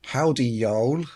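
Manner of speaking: half-hearted Southern drawl, drawn out slowly; Southern accent. Acted. Host reading out a listener's greeting, mild Southern accent. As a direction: lightly attempt a slow Southern greeting. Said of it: "was that your best Southern accent".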